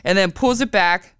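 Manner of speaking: ordinary delivery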